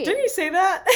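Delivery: laughing. Real, not acted. Cohost recalling his cheese catchphrase, laughing high. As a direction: laugh out the question.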